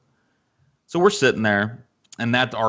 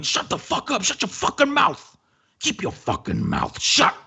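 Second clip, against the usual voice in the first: strained, hissed-yell imitation of angry cook; meltdown outburst; 13 semitones higher than usual. Cook silencing waitress, audible to diners, furious. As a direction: mimic a cook snapping, pitch jumping high. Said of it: "had a meltdown of epic proportions".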